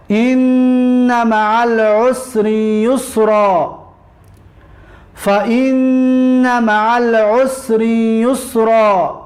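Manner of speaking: slow, drawn-out chanted recitation; measured tajwid chant; Quran recitation. Acted. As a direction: intone each verse slowly and evenly.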